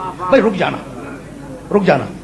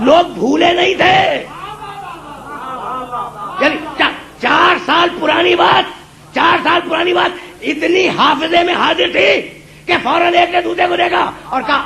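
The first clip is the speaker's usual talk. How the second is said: impassioned preaching; 6 semitones higher than usual. Acted. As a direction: ring each phrase out to the crowd. Preacher at full force. (پرجوش بلند خطابت)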